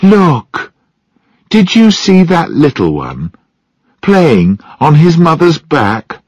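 high, delighted exclamation; excited. A narrator's reading. Someone pointing out a little one on its mother's back, excited. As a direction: call out eagerly, pitch jumping up.

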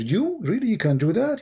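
high-pitched incredulous friend; acted disbelief. Friend doubting the husband's boast, incredulous. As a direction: play the friend who can't believe it.

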